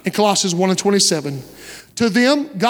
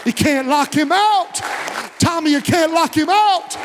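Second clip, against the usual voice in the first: preacher's climactic cry; 7 semitones higher than usual. Real, not acted. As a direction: cry it out to the whole congregation. Climax of sermon, repeated cry.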